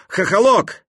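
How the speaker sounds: high, loud call across; shouting. A narrator's reading. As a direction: call his name out loud. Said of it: "Я крикнул".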